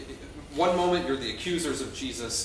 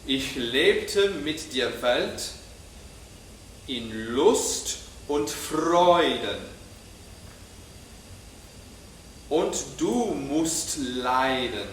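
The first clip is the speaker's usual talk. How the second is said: very slow, each syllable leaned on, lebte and Freuden stressed; syllabic emphasis; much slower than usual. Shown as a demonstration. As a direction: speak it slowly, weighting every syllable. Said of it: "really lean into the syllabic emphasis".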